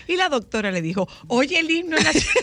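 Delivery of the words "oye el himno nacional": laughing mock quote. Doctor's words to the baby, retold.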